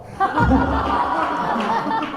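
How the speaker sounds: high breathless cackle; real laughter. Real, not acted. Storyteller amused by his friend's roast, real laughter. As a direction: burst out laughing at the comeback.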